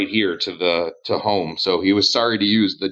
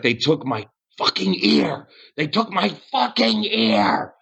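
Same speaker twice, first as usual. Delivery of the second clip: high-pitched outraged mock rant; freaking out in character; pissed off; 7 semitones higher than usual. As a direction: rage about it, pitching it high and drawing it out. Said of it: "He's just so pissed".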